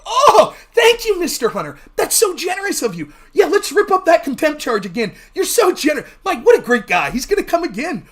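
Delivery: hammed-up grovelling thanks; fawning Republicans. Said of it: "And they'd go".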